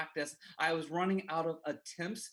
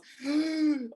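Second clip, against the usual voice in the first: high, loud gasp for air; boy winded against the wall, sharp high gasp; 10 semitones higher, 7 dB louder than usual. Retold or imitated. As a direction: gasp like the wind was knocked out of you. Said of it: "you know what that sounds like".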